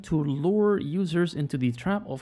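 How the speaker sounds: slow, deliberate reading aloud; story read-out. Real, not acted. Streamer reading the spam email story to viewers, slow read-aloud. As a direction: read the task story out slowly and carefully.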